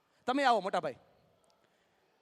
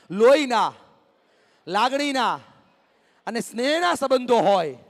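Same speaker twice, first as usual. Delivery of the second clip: loud, raised-pitch phrases with long pauses between; loud rallying call; 4 semitones higher, 7 dB louder than usual. Real, not acted. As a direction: call it out phrase by phrase for the crowd to repeat. Rallying audience to repeat after him.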